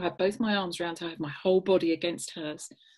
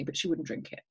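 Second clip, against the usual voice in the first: ordinary talk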